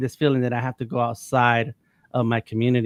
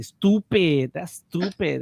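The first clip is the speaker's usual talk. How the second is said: imitated Puerto Rican accent, higher pitched; 5 semitones higher, much slower than usual. Imitating Puerto Ricans he knew, in a Puerto Rican accent. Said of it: "They have that little accent".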